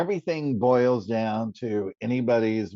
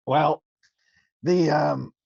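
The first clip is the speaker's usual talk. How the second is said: throat catching after a wrong swallow; clearing water from the throat mid-sentence; coughing up water; 4 semitones higher, 4 dB louder, slower than usual. Real, not acted. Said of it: "the water went down the wrong pipe".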